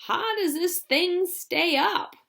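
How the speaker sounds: put-on a frightened old man protesting; high slow old grandpa, worried; alarmed Grandpa Joe. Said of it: "cried Grandpa Joe".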